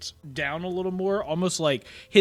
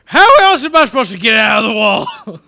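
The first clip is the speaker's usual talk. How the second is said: loud, higher, exasperated yell through the wall; frustrated shout; 4 semitones higher, 13 dB louder than usual. Acted. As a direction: bellow it out, fed up. Prisoner yells in frustration.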